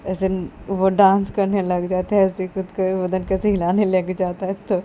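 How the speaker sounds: ordinary delivery, nothing marked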